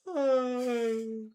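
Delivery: loud, high call across the room; high, loud call for the cat. Real, not acted. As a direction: call her name out loud. Calling the cat.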